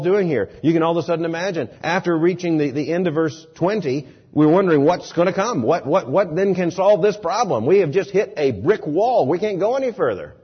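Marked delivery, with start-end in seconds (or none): none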